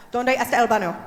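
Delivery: "¿Dónde está el baño?" rattled off fast and loud; fast native-speed Spanish; super fast. Shown as a demonstration. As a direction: blurt it out quickly like a local. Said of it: "you gotta say it super fast".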